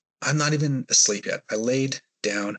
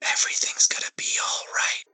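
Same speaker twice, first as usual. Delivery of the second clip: whisper. Retold or imitated. Ghost woman whispering in his ear as he lies frozen, whisper. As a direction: whisper it like a ghost leaning in close. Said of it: "she whispered in my ear".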